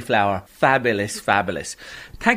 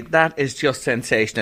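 usual speaking voice

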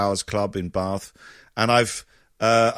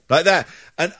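ordinary delivery

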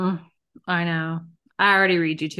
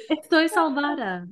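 mock Spanish; 7 semitones higher than usual. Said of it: "I don't talk like that in Spanish".

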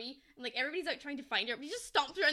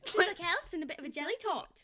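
higher-pitched imitation Cockney with a laugh; mock Cockney; 4 semitones higher than usual. Echoing the co-host's film quote, amused mock Cockney. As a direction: copy the silly Cockney line. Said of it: "It's supposed to be Cockney".

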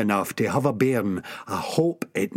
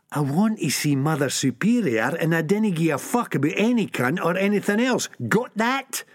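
ऊँचे सुर में ज़िद; ज़ोर देकर बात ठोकना; Sick Boy insisting; 7 semitones higher than usual. Acted.

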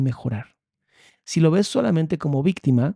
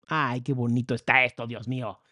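ordinary talk

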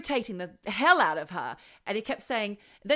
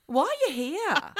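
incredulous; 7 semitones higher, 4 dB louder than usual. Retold or imitated. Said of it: "I can imagine her saying".

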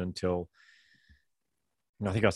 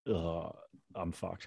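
deflated groan; 9 dB quieter, much slower than usual. Retold or imitated. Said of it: "was just go".

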